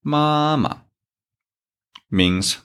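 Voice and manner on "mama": "Mama" with a light second syllable; neutral tone demo. Shown as a demonstration. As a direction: model "Mama" clearly. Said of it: "read these four pinyin with me".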